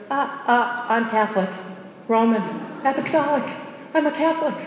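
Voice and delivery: halting, rising stammer in awe. Retold or imitated. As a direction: stammer it out, dazed and amazed. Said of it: "all Andre could stammer was".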